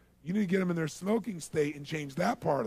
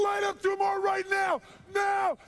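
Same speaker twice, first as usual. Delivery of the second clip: high, full-force yell; relentless loud shouted command; crazed screaming order; 6 dB louder, slower than usual. Retold or imitated. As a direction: scream it like a raving madman. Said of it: "I acted like I was crazy".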